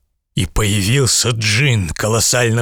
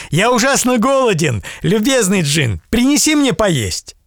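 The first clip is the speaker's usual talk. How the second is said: put-on eager young man; 11 semitones higher than usual. Aladdin commands the genie.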